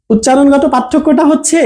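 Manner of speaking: ordinary talk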